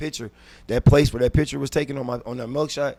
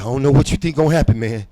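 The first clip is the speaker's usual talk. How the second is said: loud; 3 semitones higher, 8 dB louder than usual. Retold or imitated. Confronting cellmate, loud and fast.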